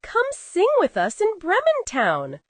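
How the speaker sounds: dog eagerly inviting; eager invitation. Acted. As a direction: call her along happily.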